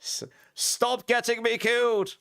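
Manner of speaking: high, slowed mock whine; mock complaint to wife. Streamer mock-scolds his wife for blocking the TV, high slowed whine. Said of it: "Told her to stop if you're getting me killed".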